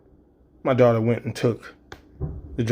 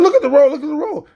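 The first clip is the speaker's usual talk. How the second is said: much higher, loud repeated shout; frantic yell; 7 dB louder, faster than usual. Retold or imitated. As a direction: shout it twice in panic. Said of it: "you're doing it again now".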